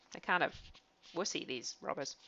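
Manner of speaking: quiet aside. Real, not acted. Teller's aside to listener.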